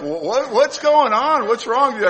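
high, loud mock alarm; imitating alarmed wife. Wife questioning husband who slammed the door, mock alarmed. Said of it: "my wife's looking at me like".

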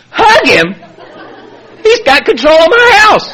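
hammed-up protest, pitch leaping up; outraged refusal. Father refusing his sponsor's advice, comic outrage.

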